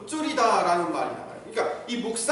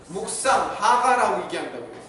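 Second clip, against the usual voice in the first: normal talking voice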